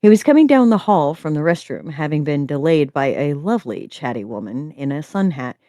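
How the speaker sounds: calm, even thriller reading; story narration. A narrator's reading. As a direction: read the mystery steadily aloud. Reads a thriller story aloud.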